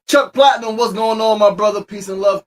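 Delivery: high, loud shout-out to a viewer; hyped welcome to a regular; excited shout-out. Real, not acted. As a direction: call out to a friend in the chat. Shouting out viewer names live.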